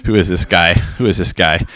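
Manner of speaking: low, dismissive muttering of a skeptic; low-pitched mock disbelief; skeptical cops. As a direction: imitate weary cops scoffing at an outsider. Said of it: "you should see what they say to me".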